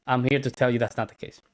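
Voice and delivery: ordinary talk, nothing marked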